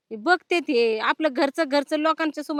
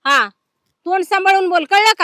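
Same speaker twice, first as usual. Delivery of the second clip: angry warning; 3 semitones higher, 7 dB louder than usual. Acted. Woman warning sister-in-law in a quarrel, angry and loud. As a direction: hammer the warning home loudly.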